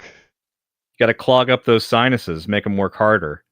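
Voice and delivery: ordinary talk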